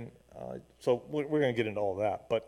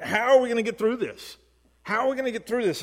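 higher-pitched, pleading question; struggling question; 7 semitones higher than usual. Acted. Preacher voicing the prophet's struggle to his congregation, troubled. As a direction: ask it as someone at a loss. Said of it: "are struggling with this question".

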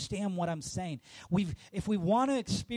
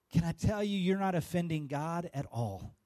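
usual speaking voice

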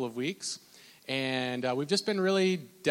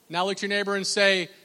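usual speaking voice

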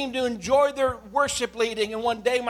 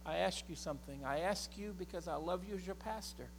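normal talking voice

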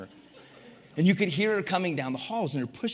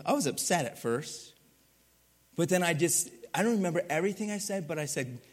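ordinary delivery

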